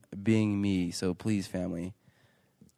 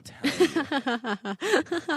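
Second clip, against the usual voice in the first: laughing; much slower than usual. Real, not acted. Laughing joke remark.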